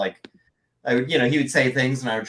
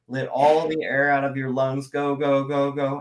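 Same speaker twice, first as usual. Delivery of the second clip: running out of air; 3 semitones higher, slower than usual. Shown as a demonstration. Actor demonstrating his practice for a throaty voice, out of air. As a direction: squeeze the words out on emptying lungs. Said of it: "when there's not a lot left".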